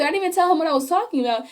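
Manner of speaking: ordinary talk, nothing marked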